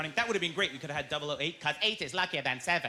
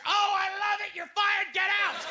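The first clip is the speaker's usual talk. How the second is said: angry boss; 13 semitones higher, 4 dB louder, slower than usual. Acted. Boss fires employee in sketch.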